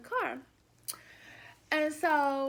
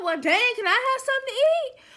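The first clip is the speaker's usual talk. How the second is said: annoyed; 9 semitones higher, 8 dB louder, faster than usual. Retold or imitated. Annoyed quote to date.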